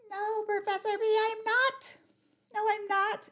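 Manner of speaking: high, squeaky protesting voice; resistant listener voice. Pretend. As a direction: whine it back in a high voice. Voicing an imagined resistant listener.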